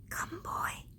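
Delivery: put-on breathless whisper of an old tree; barely a breath, tree calling the boy. A narrator's reading. Old tree whispering to the returning boy, whispered. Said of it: "she whispered".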